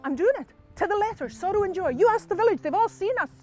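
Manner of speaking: high, insistent self-justification; defensive husband. Acted. Husband defends himself to minister.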